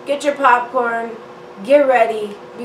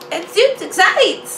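mock Disney voice; 7 semitones higher than usual. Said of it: "Why did I say super excited".

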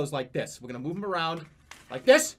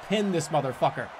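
normal talking voice

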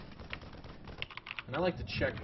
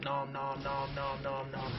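evenly chanted munching noises; nom-nom chomping; 3 semitones higher, much slower than usual. Pretend. Gamer voicing Stalkers eating units, chanted nom-noms. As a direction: imitate greedy munching on a level note.

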